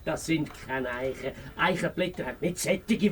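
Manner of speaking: hushed correction; whisper. Acted. Fox hiding outside, whispering a correction to the hedgehog. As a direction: whisper it so nobody inside hears.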